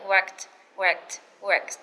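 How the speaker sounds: "worked" with a careful, distinct final t; worked said clearly. Shown as a demonstration. Teacher drilling students on "worked". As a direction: pronounce "worked" slowly, every sound clear. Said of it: "các bạn sẽ nói rõ như vậy".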